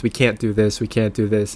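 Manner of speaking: ordinary delivery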